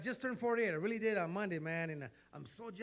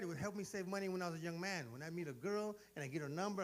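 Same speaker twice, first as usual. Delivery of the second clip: ordinary talk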